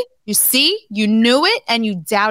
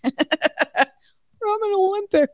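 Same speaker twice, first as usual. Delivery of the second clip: mocking impression; 11 semitones higher, much slower than usual. Mocking Kurt's boast.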